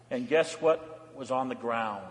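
usual speaking voice

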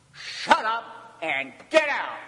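forceful, punched command; harsh, hard-hitting. Shown as a demonstration. Preacher gives the Greek's version, harsh and hard-hitting. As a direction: hit each half hard. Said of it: "a lot more harsh".

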